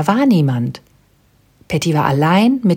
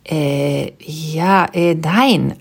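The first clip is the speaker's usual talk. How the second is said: halting, confused um-and-ah; flustered stammer; much slower than usual. A narrator's reading. Patty answers the penguin, flustered and confused. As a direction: trip over the answer, baffled. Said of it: "antwortete Patty verdattert".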